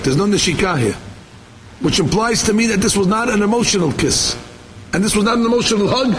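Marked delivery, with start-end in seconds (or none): none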